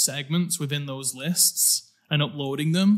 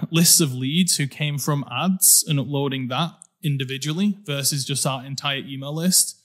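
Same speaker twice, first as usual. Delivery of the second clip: ordinary talk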